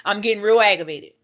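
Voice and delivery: ordinary talk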